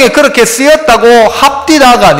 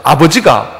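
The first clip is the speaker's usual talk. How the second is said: Sangju dialect; 6 semitones lower than usual. Shown as a demonstration. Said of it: "상주 쪽 가니까".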